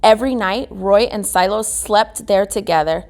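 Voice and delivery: ordinary delivery, nothing marked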